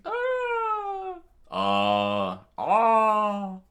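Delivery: very high loud screams; comic screams; screaming. Acted. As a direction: shriek in terror. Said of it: "they both screamed".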